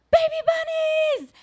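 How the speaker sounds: high, squealing burst of joy; excited kids' cry. Retold or imitated. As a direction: shriek it like thrilled little kids. Kids excited at baby bunnies.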